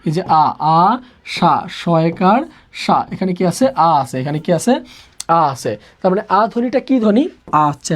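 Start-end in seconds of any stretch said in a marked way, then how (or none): 0.6-1.7 "আ", "সা" very slow and separate; isolated sounds. Shown as a demonstration. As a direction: hold "আ", pause, then "সা". Teacher isolates the word's sounds.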